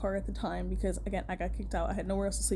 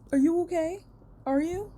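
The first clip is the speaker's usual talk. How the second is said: high, rising incredulous questions; mock concern; 7 semitones higher, 3 dB louder than usual. Asking the imagined bully after the rant, mock concern. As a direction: ask it pointedly, pitched up high.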